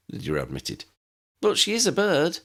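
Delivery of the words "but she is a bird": put-on much higher girlish voice; a light, high girl's voice; young woman voice. A narrator's reading.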